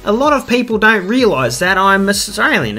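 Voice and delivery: high, hammed-up Australian accent; thick Australian accent. Shown as a demonstration. Explaining that people don't realise, thick high Australian accent. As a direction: really put on the Australian accent. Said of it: "really put that accent on".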